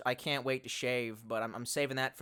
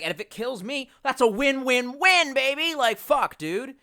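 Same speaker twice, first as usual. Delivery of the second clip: high, loud gleeful bragging, win-win-win drawn out; hyped; 10 semitones higher, 9 dB louder, slower than usual. Retold or imitated. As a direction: pump it up like a bro. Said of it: "And I was like".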